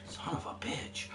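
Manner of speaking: real hissed curse under the breath; whispered swear at a missed shot; frustrated whisper. Real, not acted.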